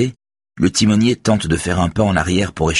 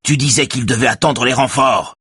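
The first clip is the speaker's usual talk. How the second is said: higher, agitated accusing voice; shouted accusation; 7 semitones higher than usual. A narrator's reading. Raphaël accuses the helmsman over reinforcements, mild shout. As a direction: throw the reproach out, moderately raised. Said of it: "crie-t-il".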